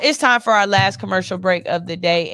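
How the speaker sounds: loud upbeat break announcement; radio host announcement. Real, not acted. Host tells listeners it's break time, upbeat and loud. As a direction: call it out to listeners like a DJ.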